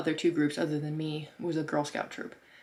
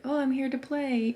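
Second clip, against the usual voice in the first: playful puppy talk; 8 semitones higher, 4 dB louder than usual. Retold or imitated. Pretending friendliness to dogs.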